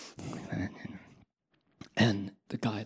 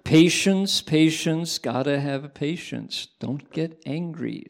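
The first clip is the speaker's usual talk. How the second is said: mantra; 4 semitones higher, 5 dB louder, slower than usual. Shown as a demonstration. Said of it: "Okay, here you go".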